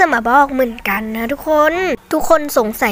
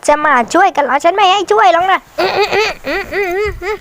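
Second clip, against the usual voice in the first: high, slow, laughing gloat; gloating taunt; 6 semitones higher than usual. Real, not acted. Hunter player teasing a survivor who comes to rescue, slow high gloating laugh. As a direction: tease the rescuer with a sly laugh.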